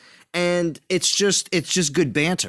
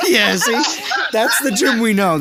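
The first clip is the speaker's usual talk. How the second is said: loud, higher, amused through laughter; laughing; 5 semitones higher, 3 dB louder than usual. Real, not acted. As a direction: crow it through a real laugh. Laughing at Jim's refusal.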